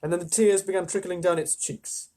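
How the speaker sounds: plain reading voice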